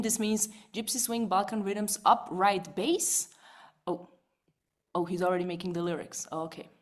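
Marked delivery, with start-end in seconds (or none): none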